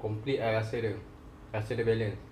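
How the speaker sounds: eating mid-sentence; talking while chewing. Real, not acted. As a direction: mouth partly full of food. Seen 2: Chicken piece held at mouth, lips greasy, mouth working between words.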